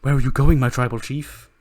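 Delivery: loud call after someone leaving; calling after him. Acted. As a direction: call out after your boss. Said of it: "And Heyman's like".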